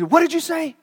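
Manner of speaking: high, sudden pounce on the mutterer; acted bully catching backtalk; sharp challenge.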